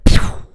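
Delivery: sharp mouth-made "pew"; bullet sound effect. Retold or imitated. Showing how the basketball flew out, bullet noise. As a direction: imitate a bullet whizzing off. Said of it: "That type of sound".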